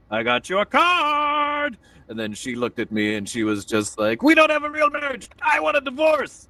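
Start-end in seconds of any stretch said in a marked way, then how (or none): 0.1-1.8 high, drawn-out sing-out of good news; gleeful announcement. Retold or imitated. Husband presents wife with an anniversary card, gleeful. Acts out cheerful anniversary greeting. 4.2-6.5 high-pitched mock outburst of a wife; upset wife. Wife answers husband's anniversary greeting, mock upset.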